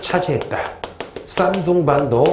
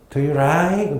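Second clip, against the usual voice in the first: "like" said as "rike", Thai English style; Thai English accent; much slower than usual. Shown as a demonstration. Teacher contrasting Korean and Thai English pronunciations, Thai English accent. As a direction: mimic Thai English "like" with an r sound. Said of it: "거긴".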